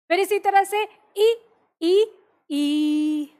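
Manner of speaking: ई repeated and drawn out; stretched vowel. Shown as a demonstration. Teacher showing how ई is lengthened, stretched. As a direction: pull each ई long. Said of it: "ये ऐसे चलेगा".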